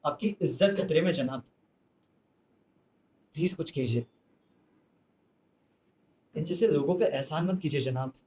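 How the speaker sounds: soft, low earnest plea to the judge; hushed plea. Acted. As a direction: drop the voice and plead with the judge. Quiet closing plea to judge.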